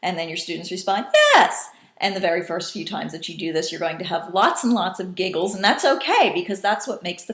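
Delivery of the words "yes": very high, loud "yes"; high-pitched. Shown as a demonstration. Students echoing the teacher's high call, high-pitched. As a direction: squeal "yes" right at the top. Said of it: "high-pitched tone of voice".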